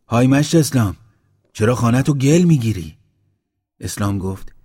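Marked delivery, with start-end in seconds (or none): 0.1-3.0 loud, raised-pitch hail; calling out. A narrator's reading. Mashti Baba hailing Islam as he plasters, loud call. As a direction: call up to someone busy working. Hails Islam working on house.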